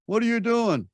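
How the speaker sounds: brain's startled protest; alarmed brain. Retold or imitated. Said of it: "my brain says".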